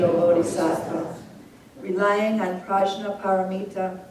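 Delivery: measured, solemn recitation of scripture, each line repeated; sutra recitation. Acted. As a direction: read each verse out steadily, then say it again.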